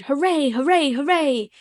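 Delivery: loud, high joyful shout; shouted hooray. A narrator's reading. Colin cheering about the garden door, loud shout. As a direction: cheer it out at full voice. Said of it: "Colin would probably have shouted".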